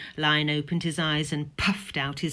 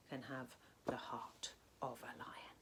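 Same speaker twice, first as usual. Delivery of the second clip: whisper; 4 semitones lower, 23 dB quieter than usual. Acted. Teacher's intro, dramatic hush.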